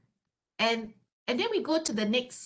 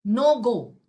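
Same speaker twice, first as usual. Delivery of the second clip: blunt boss verdict; 9 dB louder than usual. Retold or imitated. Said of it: "And he says".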